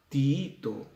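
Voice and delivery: "Tiito" as ti-Í-to, both i's heard; double-i hiatus. Shown as a demonstration. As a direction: separate the two i sounds of "Tiito". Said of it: "dos vocales cerradas".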